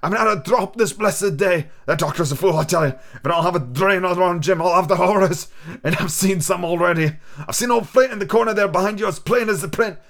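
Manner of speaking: put-on a sick old sailor coaxing the boy; old pirate captain. Captain begging for rum.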